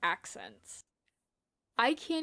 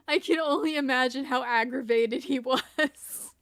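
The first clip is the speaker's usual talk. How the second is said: laughing through words; 6 dB louder than usual. Real, not acted. Laughing after chat joke.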